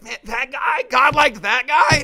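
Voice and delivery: high-pitched, incredulous mock outrage; hammed-up, squawking indignant question; galled disbelief. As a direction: play a put-out rival who can't believe it. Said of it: "it's just gonna grind their gears".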